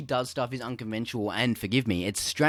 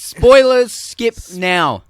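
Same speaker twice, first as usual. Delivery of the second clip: loud, high spoiler alarm; shouted warning; 8 semitones higher, 16 dB louder, much slower than usual. Acted. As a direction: yell it like a siren warning viewers.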